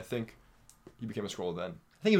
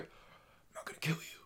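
whispered quote; 12 dB quieter, slower than usual. Retold or imitated. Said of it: "he's like".

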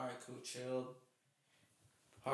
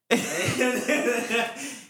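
bursting into laughter; big hearty laugh; loud laugh; 9 semitones higher, 8 dB louder than usual. Real, not acted. Laughing while telling wedding story.

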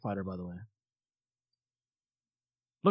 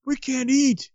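high-pitched mock outrage; pissed-off Wicket; 10 semitones higher, 4 dB louder than usual. Wicket years later blaming them for his destroyed planet, mock pissed off. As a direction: complain like an indignant Ewok, comically high. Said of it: "like, Wicket's like, pissed off".

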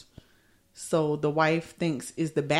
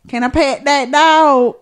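put-on little boy's country accent, slow and loud; 8 semitones higher, 9 dB louder, much slower than usual. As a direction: drawl it like a little country boy. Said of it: "he got a real country accent".

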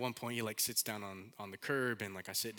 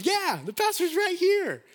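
much higher, louder and slower put-on voice; church member's voice; 13 dB louder than usual. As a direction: play the church member loudly cheerful, drawn out. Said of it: "And our church member goes".

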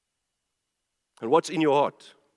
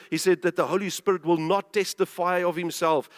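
usual speaking voice

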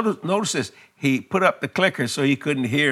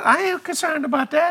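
unbothered Jesus; 13 semitones higher than usual. Acted. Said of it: "Jesus said".

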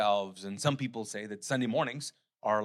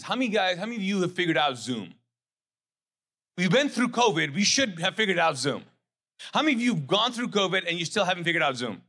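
teasing question; 8 semitones higher, 6 dB louder, faster than usual. Real, not acted. Preacher quizzing the congregation.

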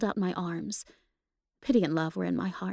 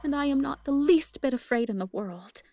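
tearful; 6 semitones higher, 3 dB louder than usual. A narrator's reading. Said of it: "I cried through hot tears".

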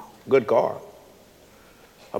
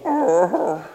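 dog's touched sigh; 8 semitones higher than usual. Retold or imitated. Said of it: "I've seen some dogs go".

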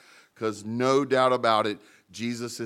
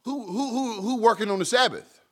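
much higher, sputtering who who who; mock outraged leaders; 9 semitones higher than usual. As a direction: squeak out the leaders' indignation. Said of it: "they're like".

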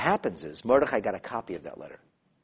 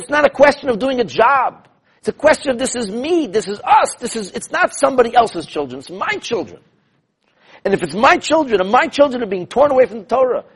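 high, loud, urgent sermon voice; fervent rebbe pleading over his children, loud and high; impassioned preaching; 12 semitones higher, 8 dB louder than usual. Acted. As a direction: drive it home like a preacher at full force.

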